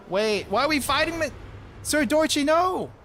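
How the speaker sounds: high, loud cry of disbelief; panicked plea to the old friend, shouted; desperate shout. Acted. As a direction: yell at your friend to stop fighting. Pleading as fight begins.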